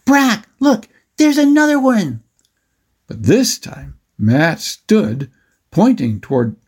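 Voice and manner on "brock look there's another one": put-on high boyish shout; acted kid yelling to his brother; excited shouting. A narrator's reading. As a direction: interrupt with an excited high call. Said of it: "Matt's shouts then interrupted".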